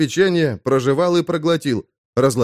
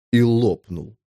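ordinary delivery

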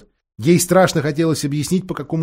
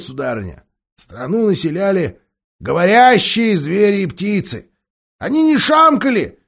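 dwarf annoyed at the shouting; grumpy deaf dwarf; 4 semitones higher, 5 dB louder than usual. A narrator's reading. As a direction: grumble like an irritated old dwarf. Dwarf rambling.

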